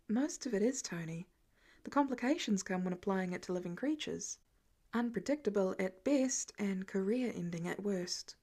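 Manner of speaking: soft, hushed, barely above a whisper. Acted. Banner murmuring privately to Tony, hushed. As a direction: keep it low so the boy can't hear. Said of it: "the low conversation behind them".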